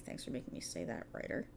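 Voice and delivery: very soft, voiceless grumble to the writer; grumbled under the breath; muttered aside. Acted. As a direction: drop to a near-whisper, sarcastic thanks. Sour aside to the unseen writer.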